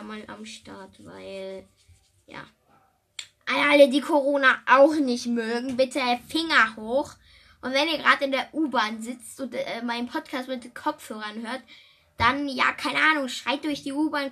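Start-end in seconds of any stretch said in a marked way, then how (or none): 3.5-14.3 loud, pumped-up appeal to the audience; rally the listeners, loud; loud rallying call. Real, not acted. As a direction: call out to everyone like a cheerleader. Rallying listeners against Corona.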